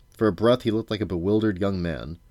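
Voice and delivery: ordinary delivery, nothing marked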